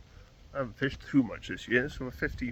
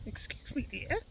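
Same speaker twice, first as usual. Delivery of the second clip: put-on old lady voice. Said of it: "this woman comes up to him and says".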